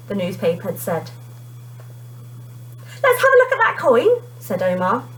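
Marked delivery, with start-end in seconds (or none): 3.0-4.2 put-on bright, higher kid voice; a young boy, eager; boy's voice. A narrator's reading. Character voice for Omar.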